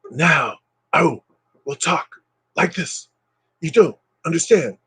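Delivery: lightly done Shatner halting delivery; a hint of choppy Shatner pauses; William Shatner impression. Shown as a demonstration. Streamer greets viewers, trying a halting Shatner impression. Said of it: "I'm trying to do William Shatner".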